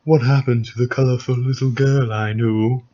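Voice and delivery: a touch of villain voice; brooding villain read; Sephiroth voice. Acted. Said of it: "You did the Sephiroth voice for What's-His-Face".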